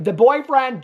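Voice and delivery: usual speaking voice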